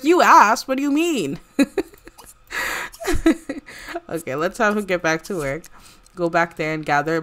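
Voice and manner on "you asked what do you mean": high and loud, laughing while speaking. Pretend. Coworker laughing off Celine's outrage. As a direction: laugh it off with a shrug.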